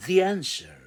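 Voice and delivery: the answer, the said as thee with tongue out. Shown as a demonstration. Teacher showing the tongue for the. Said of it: "لازم تطلع لسانك".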